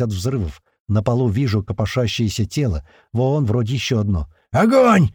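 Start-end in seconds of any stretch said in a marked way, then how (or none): 4.5-5.1 high, loud wild shout; frantic yell. A narrator's reading. Fighter ordering fire in the corridor, frantic loud yell. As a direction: scream the order in a voice not your own. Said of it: "ору не своим голосом".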